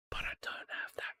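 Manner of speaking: breathy whispered aside. Real, not acted. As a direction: whisper it as if to yourself. Whispers about the missing car.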